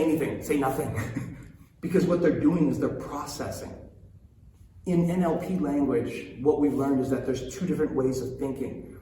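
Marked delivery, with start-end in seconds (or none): none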